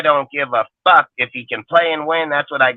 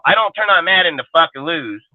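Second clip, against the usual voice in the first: ordinary talk, nothing marked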